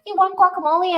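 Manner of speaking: high, loud mock shop owner offering; friendly shopkeeper. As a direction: play the generous shop owner. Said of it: "they just are like".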